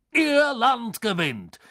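high, drawn-out announcer's cry; triumphant call. A narrator's reading. Beckmann announces the winner, long high shout. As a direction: stretch the victory shout slowly over the stadium. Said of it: "rief Beckmann".

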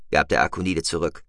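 usual speaking voice

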